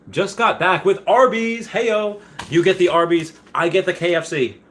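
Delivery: high, playful hollering; hammed-up hype. Reading a viewer's Arby's message, hammed-up hype.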